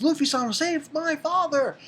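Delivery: put-on high desperate call; Shirahoshi. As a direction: imitate a princess crying out to be saved. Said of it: "then she says".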